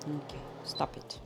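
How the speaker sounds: whispered, fast and hushed; hushed quick whisper; whispered aside. Real, not acted. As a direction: breathe it under your breath.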